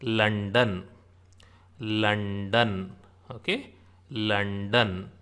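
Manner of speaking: "London" said slowly as two separate beats, lun-dun; demonstrated pronunciation. Shown as a demonstration. Teacher shows l as in London, slowly sounded out. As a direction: sound out "London" with a held n in the middle. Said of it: "it is pronounced like L O in".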